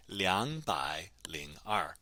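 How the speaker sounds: slow, syllables drawn out; slow model pronunciation. Shown as a demonstration. Teacher offering the other form of two hundred two, slow model pronunciation. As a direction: stretch each syllable for learners to copy.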